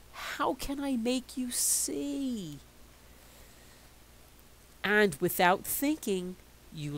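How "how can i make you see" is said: put-on despairing child.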